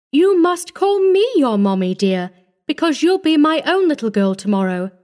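high-pitched insistence, easing down; forced cheer. A narrator's reading. Woman telling the child to call her Mommy, forced cheer. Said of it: "laughed with an effort".

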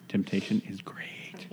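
slow breathy whisper of temptation. Acted. Repeats line in whisper.

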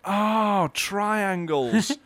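high, drawn-out mock wonder; awed realisation. Dairy firm discovering triangles, in high mock wonder. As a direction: marvel at it like a great discovery. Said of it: "And they were like".

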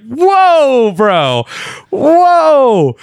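high, loud drawn-out disbelief; hammed-up scandalised reaction; shocked. Reacting to Santa's lewd offer, mock shock.

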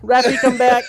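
loud, high call after someone. Real, not acted. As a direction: shout after them to come back. Calling after a leaving friend.

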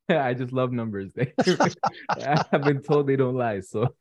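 amused confession bursting with laughter; laughing. Real, not acted. Guest joking to host about loving numbers, laughing.

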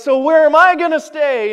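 indignant, voice pushed up; upset. Retold or imitated. Miser demands of the angel, loud and upset.

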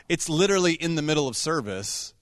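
ordinary delivery

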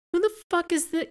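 very high-pitched, incredulous squeak; comically baffled falsetto; mock bafflement. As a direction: imitate bewildered disbelief way up high. Acting out his baffled reaction.

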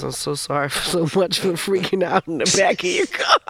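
apology breaking up into a high wail; hammed-up tearful freak-out; mock crying. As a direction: imitate blubbering, climbing into a squeak. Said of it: "I was still crying so much".

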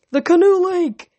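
slow, high, loud shout. A narrator's reading. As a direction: shout it across the crowd. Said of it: "Connor Stoll shouted".

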